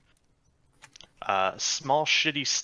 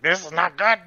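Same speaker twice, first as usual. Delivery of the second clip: loud, higher-pitched goblin voice; yelling goblin; 6 semitones higher, 8 dB louder than usual. Acted. Captured goblin protests in his own tongue, alarmed yell. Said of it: "He's speaking in Goblin right now".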